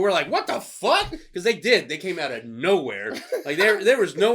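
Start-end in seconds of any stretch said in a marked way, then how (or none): 0.3-1.1 high, shocked exclamation; startled. Retold or imitated. Cops appearing out of nowhere, startled high outburst. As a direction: jolt of shock.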